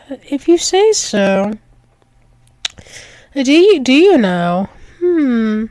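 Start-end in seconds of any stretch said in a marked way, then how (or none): none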